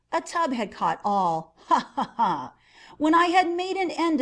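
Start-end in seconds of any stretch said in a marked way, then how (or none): 1.7-2.5 short triumphant mad laugh; maniacal laugh. Acted. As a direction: cackle at your own cleverness. Gloating madman laughs alone.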